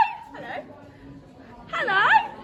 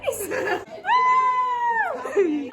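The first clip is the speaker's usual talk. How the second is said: long, high-pitched laughing squeal; 11 semitones higher than usual. Real, not acted. Vlogger after friend's introduction, squealing laugh.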